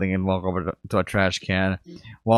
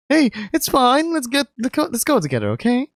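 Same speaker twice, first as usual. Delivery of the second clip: reassuring friend. Acted. Mark comforting.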